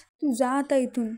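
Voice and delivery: ordinary delivery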